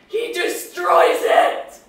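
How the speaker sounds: loud, slow, breathy outburst; anguished climax of the seagull tale; anguished cry. Acted. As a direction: tear the words out slowly.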